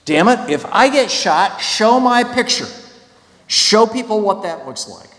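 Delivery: high, emphatic protest voice; impassioned plea, pitched well up; defiant demand. Retold or imitated. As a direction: voice the kids' indignant demand.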